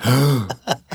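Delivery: acted gasp of realisation; audible mock-shocked intake of breath; sudden gasp. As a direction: gasp as the truth dawns. Acting out his realisation in audience.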